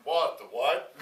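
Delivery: exaggerated startled reaction; mock startled. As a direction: blurt it out loud, caught off guard. Startled at being recognized.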